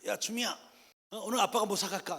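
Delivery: loud, high, jolly dad on the phone; imitating an eager father phoning home; acted hearty calling. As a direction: call out to your child with overdone cheer. Father phones child, all overhear.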